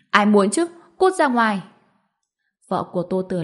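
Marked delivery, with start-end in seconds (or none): 0.1-1.6 loud, higher snapping dismissal; angry rejection. A narrator's reading. Girl pushing little sister away, angry and loud. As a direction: throw the gift back in their face.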